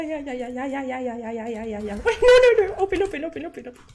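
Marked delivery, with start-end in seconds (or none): 0.0-2.0 quiet mutter. Real, not acted. Muttered rapid yeahs.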